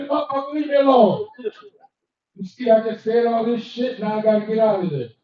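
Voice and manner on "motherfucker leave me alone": irritated. Acted.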